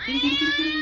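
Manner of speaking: very high sing-song kitty call; coaxing a cat. Acted. Dad in the rafters calling the cat, very high sing-song.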